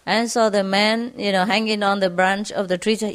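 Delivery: plain reading voice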